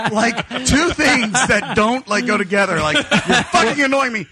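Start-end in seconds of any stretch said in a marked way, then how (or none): 3.0-4.3 high, loud, drawn-out imitation of irritation; slow exaggerated snap at a stranger; mock annoyed outburst. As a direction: act out his annoyance, laughing through it. Re-enacting friend's annoyed outburst.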